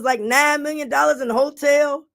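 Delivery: ordinary talk, nothing marked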